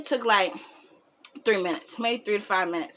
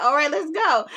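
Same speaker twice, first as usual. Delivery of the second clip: higher retold line, fresh from laughing; amused mimicry; 5 semitones higher than usual. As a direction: repeat the friend's breezy line, tickled. Repeats friend's line after laughing.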